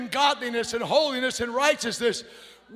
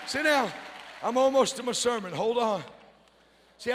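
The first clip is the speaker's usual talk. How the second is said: normal talking voice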